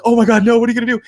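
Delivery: high, loud alarmed cry of oh my God; panicking at the screen, loud and high; mock panic. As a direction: yelp it like a scared viewer. Panicked reaction to horror game.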